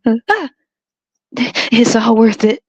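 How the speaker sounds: high-pitched hammed-up outcry; mock scream. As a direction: imitate a mother crying out in pain. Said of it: "you look down and scream".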